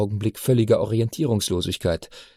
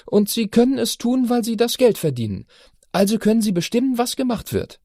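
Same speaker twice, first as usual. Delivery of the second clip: put-on high, insistent girl's voice; teenage daughter, indignant; 11 semitones higher than usual. A narrator's reading. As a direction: argue like a righteous teen. Chiara concludes.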